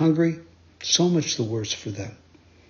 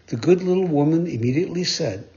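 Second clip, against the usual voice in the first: plain reading voice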